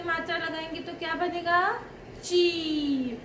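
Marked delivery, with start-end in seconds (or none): 2.2-3.2 ची with a long, higher ee; long-vowel demonstration. Shown as a demonstration. Teacher answers what च becomes, long-drawn ची. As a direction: stretch the ee in ची. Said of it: "बड़ी की मात्रा का बड़ा उच्चारण".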